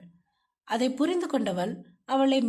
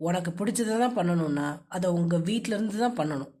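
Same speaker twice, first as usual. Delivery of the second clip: low, controlling husband's voice; low-pitched, domineering man; stern husband; 6 semitones lower than usual. Acted. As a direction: lay down the rule to your wife, low.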